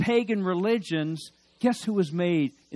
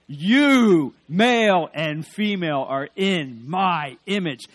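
booming, much louder proclamation; God's loud declaration; 2 semitones higher, 10 dB louder, slower than usual. Acted. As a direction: proclaim it like God declaring to mankind. God declaring to all people.

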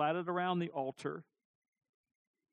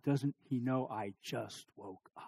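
low, slow, soft grumble; weary mock inner complaint; just-woken wife; 6 semitones lower, 6 dB quieter than usual. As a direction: imitate a sleepy spouse, slow and low. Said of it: "In her mind".

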